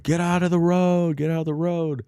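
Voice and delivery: high-pitched mock yell, no real volume; imitated yelling. Haulers trying to come in at people, mock yelling. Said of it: "on the horns yelling at people".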